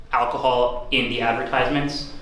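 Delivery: usual speaking voice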